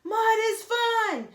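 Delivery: high, loud group shout, drawn out; a crowd of kids yelling back. Acted. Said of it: "shouted the kids at the start line".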